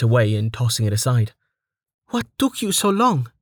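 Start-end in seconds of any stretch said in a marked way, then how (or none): 2.1-3.3 high teary reproach; tearful Amelia. A narrator's reading. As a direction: demand it with relief and hurt.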